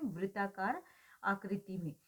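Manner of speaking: ordinary talk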